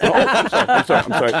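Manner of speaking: high-starting, tumbling mock apology; flustered apology. Guest replaying his retreat from the boss, flustered apology. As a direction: blurt it repeatedly in a fluster.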